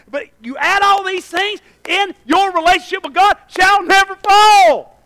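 loud, high, slow build; rising sermon shout; preaching climax. Real, not acted. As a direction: drive it home to the church.

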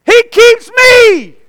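high, loud, drawn-out preaching cry; overjoyed preacher's holler; exultant shout. Real, not acted. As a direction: shout it slowly, stretching each phrase. Preacher exults over good news.

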